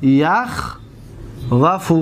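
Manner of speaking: یخوف stretched very slowly as ya-khvaf, rising at the end; teacher sounding out یخوف slowly for the class; slow drawn-out demo. Shown as a demonstration.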